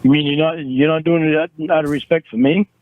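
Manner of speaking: normal talking voice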